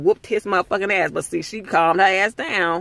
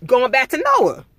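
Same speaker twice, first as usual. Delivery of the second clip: usual speaking voice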